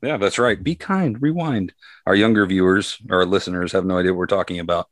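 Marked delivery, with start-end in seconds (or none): 0.6-1.7 high, bright slogan chant; high singsong slogan. Retold or imitated. Repeating the slogan playfully.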